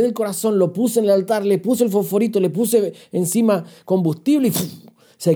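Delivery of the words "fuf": blown "fuf" of fuel igniting; whoosh of flames. Retold or imitated. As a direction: imitate fire catching with a puff of air. Imitates fuel catching fire. (soplido imitando una llamarada)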